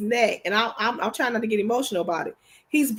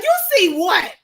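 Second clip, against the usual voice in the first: higher, louder challenge; indignant retort; 7 semitones higher, 5 dB louder than usual. Retold or imitated. Wife challenging husband's accusation, higher and louder. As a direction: snap back a challenge. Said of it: "I'm like".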